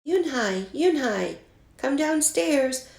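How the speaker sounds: mother calling her daughter down; calling upstairs. A narrator's reading. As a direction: call up the stairs to a daughter. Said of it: "Mother called up to Yoon-hai".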